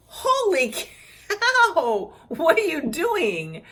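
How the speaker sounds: high, loud cry of pain as the needle goes in; shocked yelp. Retold or imitated. To the nurse digging for a vein, a loud shocked yelp. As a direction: yelp it out, half laughing. Painful needle, retold outburst.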